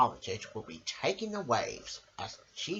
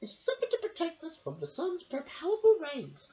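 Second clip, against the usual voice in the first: much higher, squeaky little lady voice; Missus Armitage voice; 13 semitones higher than usual. Acted. Missus Armitage asks her dog a question, high and squeaky. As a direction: pipe it up like a fussy little lady. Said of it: "said Missus Armitage".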